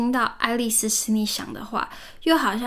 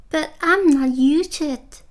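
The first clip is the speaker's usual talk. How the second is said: piteous; 4 semitones higher, 4 dB louder than usual. A narrator's reading. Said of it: "pleaded poor Alice in a piteous tone".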